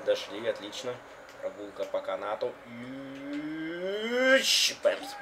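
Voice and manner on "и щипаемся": silly drawn-out voice. Pretend. Odd drawn voice.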